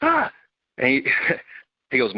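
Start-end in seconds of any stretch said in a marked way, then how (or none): 0.0-0.3 high, confused yelp on waking; dazed startled cry. Retold or imitated. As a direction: jolt awake, lost and alarmed. Waking dazed after the blast.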